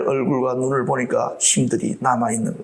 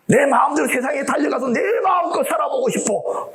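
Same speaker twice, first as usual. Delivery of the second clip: rebellious shout; 11 semitones higher than usual. Pretend. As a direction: shout it like a runaway wanting freedom. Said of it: "막 그러잖아".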